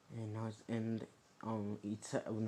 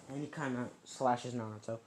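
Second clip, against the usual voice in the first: ordinary delivery, nothing marked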